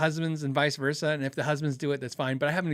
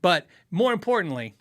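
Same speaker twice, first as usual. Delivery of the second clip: ordinary talk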